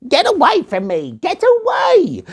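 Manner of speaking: shrill high panicked yelling; panicked gnome. Acted. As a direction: scream for help as the eagle grabs. Gnome attacked by eagle.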